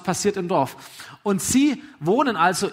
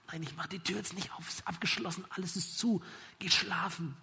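quiet, firm no; hushed refusal; 10 dB quieter than usual. Acted. Tired father refusing to open the door, hushed refusal. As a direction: keep it down but refuse.

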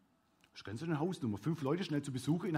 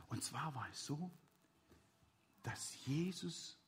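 very soft, low, near-whisper; hushed; 6 semitones lower, 15 dB quieter than usual. Real, not acted. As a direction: lower the voice to keep the room quiet. Hushed talk after quieting children.

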